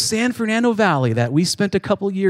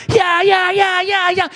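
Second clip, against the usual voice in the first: mock battle yells; 8 semitones higher than usual. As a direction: yell with each strike. Combination of kicks, shouting.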